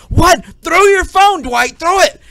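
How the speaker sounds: loud, high panicked yelling; hammed-up alarm, yelling at Dwight; urgent mock shout. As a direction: scream the warning down the phone. Repeat of the phone warning.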